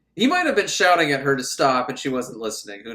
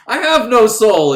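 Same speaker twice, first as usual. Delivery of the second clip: loud, high, drawn-out mock defiance; defiant declaration; 6 semitones higher, 12 dB louder than usual. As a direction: proclaim it with theatrical force.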